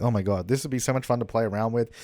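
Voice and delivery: ordinary delivery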